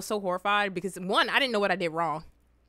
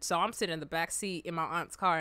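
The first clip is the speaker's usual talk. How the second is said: ordinary talk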